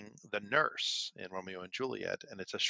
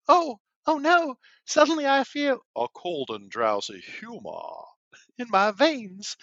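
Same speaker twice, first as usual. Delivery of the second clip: put-on high, loud Valley Girl meets Southern Belle drawl; hammed-up Valley Girl and Southern Belle Juliet; 13 semitones higher, 10 dB louder, slower than usual. Friend as Juliet, mock Valley Girl Southern Belle accent. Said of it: "half Valley Girl, half Southern Belle".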